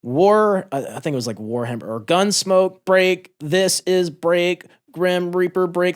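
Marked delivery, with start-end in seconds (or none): 0.0-0.7 loud, higher call sign; radio call. Retold or imitated. Soldier calling for help on the radio, loud and higher. 2.0-6.0 loud, higher, very slow radio procedure; frantic radio call. Retold or imitated. Soldier repeating radio call signs under fire, loud and slow.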